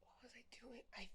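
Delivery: unvoiced hushed reply; whisper. Real, not acted. Player answering the caretaker, whispered.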